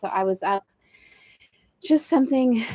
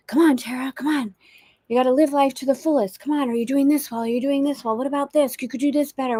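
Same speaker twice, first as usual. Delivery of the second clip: higher-pitched inner voice urging you on; pushy motivator; 5 semitones higher than usual. Acted. Inner voice driving her to do better, pushy motivating. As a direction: push yourself with one nagging demand after another. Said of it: "I would recognize this as a voice of motivation".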